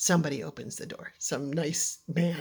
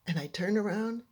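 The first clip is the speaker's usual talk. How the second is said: usual speaking voice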